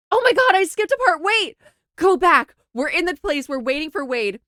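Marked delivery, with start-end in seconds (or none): none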